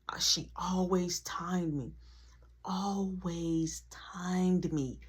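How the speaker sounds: hushed whispered aside. Real, not acted. Confiding to listeners about strict mom, whispered. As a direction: whisper it, slow and low.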